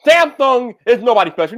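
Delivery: ordinary delivery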